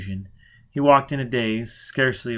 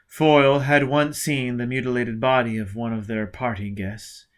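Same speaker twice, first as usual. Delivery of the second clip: usual speaking voice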